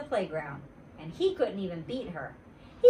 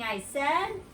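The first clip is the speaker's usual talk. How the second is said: usual speaking voice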